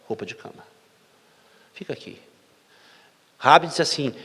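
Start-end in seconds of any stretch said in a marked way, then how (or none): 1.7-2.2 a very soft, intimate "Fica aqui"; hushed invitation. Shown as a demonstration. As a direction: draw them in close with "Fica aqui" almost under your breath. Intimate whispered invitation repeated.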